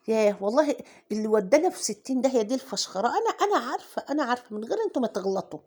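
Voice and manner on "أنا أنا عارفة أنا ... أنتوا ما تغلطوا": hurried self-mockery. Real, not acted.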